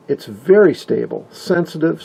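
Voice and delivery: usual speaking voice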